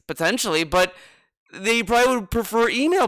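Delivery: ordinary delivery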